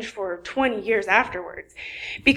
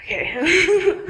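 nervous laugh; 3 semitones higher than usual. Real, not acted. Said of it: "sorry, I'm nervous".

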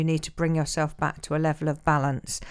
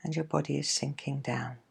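guided meditation; 3 dB quieter than usual. Acted. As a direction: ease them into calm, gently. Leads a guided relaxation.

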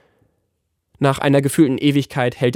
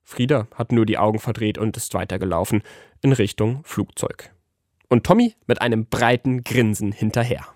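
ordinary delivery, nothing marked

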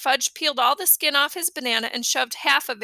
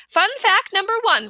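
chirpy tour guide; 7 semitones higher, 4 dB louder than usual. A narrator's reading.

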